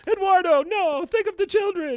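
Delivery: very high, loud mock heroine wail; melodramatic cry. Heroine calls after Eduardo jumping off cliff, melodramatic cry. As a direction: call out in hammed-up alarm.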